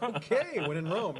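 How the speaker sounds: high, laughing give-in; laughing mock acceptance; laughing resignation. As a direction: shrug it off through a laugh. Retelling his resigned reaction.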